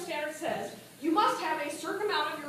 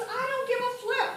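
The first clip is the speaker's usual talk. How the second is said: higher-pitched mock indignation; retold exasperation, pitched up; retold anger; 8 semitones higher, 3 dB louder than usual. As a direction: imitate a fed-up caller. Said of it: "I am so mad".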